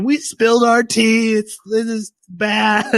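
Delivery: high, slow, cutesy alarm; panicked high voice. Retold or imitated. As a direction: whine it like a flustered cartoon cell. Macrophage calls in panic.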